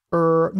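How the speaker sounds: うー as a held "rrr", tongue tip curled back; retroflex R. Shown as a demonstration. Teacher introducing the beginners' method, retroflex R. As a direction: curl the tongue and voice うー as R. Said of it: "舌先を裏に巻いて、".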